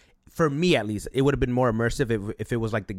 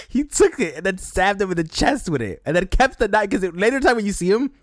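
higher, louder, words through laughter; laughing while talking; 9 semitones higher, 4 dB louder than usual. Real, not acted. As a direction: talk through the giggles. Laughing while retelling stabbing.

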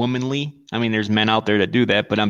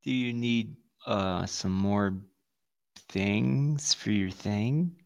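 soft, slow tiptoeing question; hushed slow mock care; 3 semitones lower, 10 dB quieter, much slower than usual. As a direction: ask it slowly and softly, walking on eggshells. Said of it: "it's more like".

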